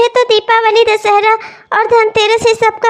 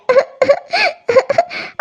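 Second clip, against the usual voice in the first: choked sob breaking out; wife sobs alone about the lost shopping money; sobbing cry; 4 semitones higher, 3 dB quieter than usual. Acted. Crying over lost money.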